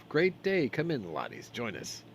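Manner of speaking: hearty greeting, starting high and loud; jovial dwarf host, easing off at the end; smiling dwarf. A narrator's reading. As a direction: welcome guests in as a cheery dwarf. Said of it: "greeted by a smiling dwarf".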